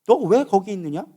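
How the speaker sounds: slow, measured question in God's voice. Acted. Preacher as God asking Elijah why he is there, measured questioning. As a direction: ask it slowly, without raising the voice.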